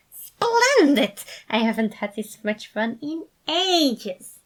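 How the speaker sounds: very high, loud burst then slowing; gushing. A narrator's reading. Remus gushing to the worried others, high and loud. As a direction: gush with manic delight. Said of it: "Remus gushed".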